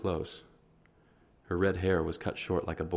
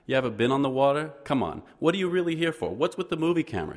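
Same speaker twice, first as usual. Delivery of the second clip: higher, louder probing questions; teasing interrogation; 8 semitones higher, 4 dB louder than usual. A narrator's reading. Fish woman grilling the newcomers about the camera, teasing and loud. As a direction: poke at them, half amused.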